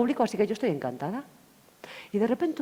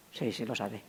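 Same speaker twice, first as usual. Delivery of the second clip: dropped to a low murmur; hushed admission; 9 semitones lower, 9 dB quieter, slower than usual. Retold or imitated. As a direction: say it low, almost to yourself. Client's quiet admission retold. (confesión en voz baja)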